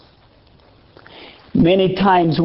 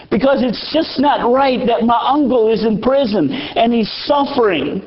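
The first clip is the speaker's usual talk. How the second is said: higher, pleading retelling of a grieving nephew; a touch of despair, higher voice; distraught young man; 6 semitones higher than usual. Retold or imitated. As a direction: voice the young man's anguish, pitched up.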